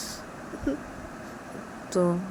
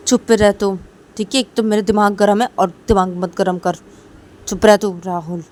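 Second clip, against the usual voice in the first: loud irritated shout; angry sister; 2 semitones higher, 8 dB louder than usual. Acted. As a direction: shut him up, fed up. Shruti angry at Rahul.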